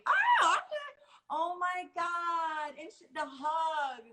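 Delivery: high excited squeal; excited shriek. Real, not acted. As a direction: squeal with delight at the photo. Host reacts to photo.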